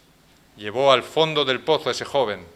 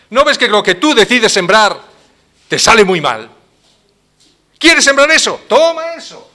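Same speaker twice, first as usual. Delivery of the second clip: higher and louder ironic taunt; mock them as God, louder and higher; 5 semitones higher, 10 dB louder than usual. God ironically rebukes people. (Dios irónico, más agudo y fuerte)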